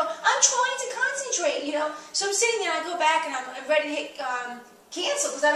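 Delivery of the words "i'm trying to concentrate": higher and slow, pointedly exasperated; exasperated retort. Retold or imitated. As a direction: spell it out slowly at him.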